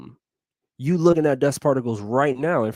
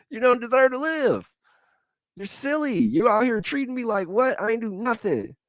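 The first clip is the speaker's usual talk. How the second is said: high, mock indignant; playful high outrage; mock outraged Jesus; 12 semitones higher than usual. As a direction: squeak it out offended. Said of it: "could have been like".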